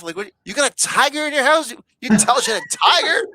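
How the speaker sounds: high, incredulous retold outburst; mock disbelief. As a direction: question it like an astonished onlooker, hammed up. Retold commotion over tiger in house.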